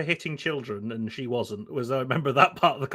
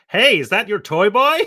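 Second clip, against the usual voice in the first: high, loud, drawn-out American woman; poor American accent; 7 semitones higher, 9 dB louder than usual. Retold or imitated. Nosy American passenger quizzing a traveller, American accent. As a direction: call it out in a mock American accent. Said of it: "apologies for the poor American accent".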